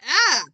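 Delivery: very loud, high cry of alarm; shocked cry. Acted. Apollo finding his bracelet gone, shocked cry.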